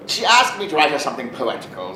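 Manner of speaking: ordinary delivery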